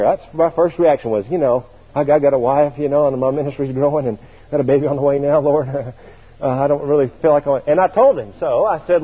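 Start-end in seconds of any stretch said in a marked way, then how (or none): none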